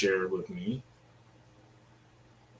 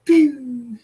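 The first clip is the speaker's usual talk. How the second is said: pew sound; 12 semitones higher, 5 dB louder than usual. Retold or imitated. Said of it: "I just go".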